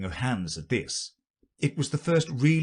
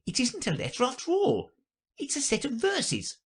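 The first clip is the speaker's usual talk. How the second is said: high-pitched rabbit reporting; high, light courtier rabbit. Acted. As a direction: explain in a very high voice. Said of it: "and added".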